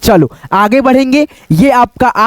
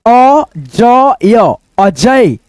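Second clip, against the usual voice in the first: slow blending; much slower than usual. Shown as a demonstration. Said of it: "तीनों को जोड़ दो".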